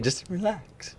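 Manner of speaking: hint of the coach soothing him; soft reassurance. Freshman recalling his coach's calming words, soft.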